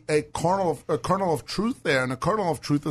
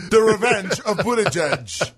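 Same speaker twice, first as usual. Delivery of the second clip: slow, loud movie-title delivery; higher, drawn-out mock menace; mock dramatic; 5 semitones higher, 5 dB louder, slower than usual. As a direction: announce it like a hammy sequel title. Echoes it like a film title.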